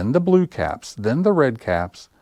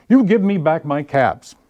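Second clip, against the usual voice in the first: higher, louder telling-off; scolding demand; 7 semitones higher, 5 dB louder than usual. Acted. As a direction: demand the caps back, cross. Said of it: "This made the peddler angry".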